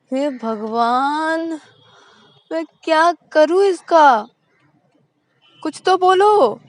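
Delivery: high, loud, drawn-out despairing cry; exasperated girlfriend. A narrator's reading. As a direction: throw up your hands in despair. Exasperated Shreya, voiced by narrator. (हताश, ऊँची और तेज़ आवाज़ में)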